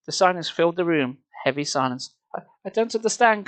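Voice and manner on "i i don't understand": high, stammering confusion; high stammered bewilderment. Acted. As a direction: stumble over the words, lost. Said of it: "Carly Beth stammered finally".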